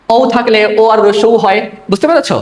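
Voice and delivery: normal talking voice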